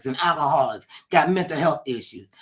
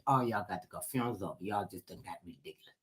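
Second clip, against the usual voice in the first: hushed dismissal; 8 semitones lower, 12 dB quieter, faster than usual. Retold or imitated. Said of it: "they said".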